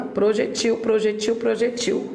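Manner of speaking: "projetil" repeated with the stress on the final -TIL; oxítona projetil. Shown as a demonstration. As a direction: stress the last syllable of "projetil" each time. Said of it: "oxítona". ("projetil" repetido, tônica na última sílaba)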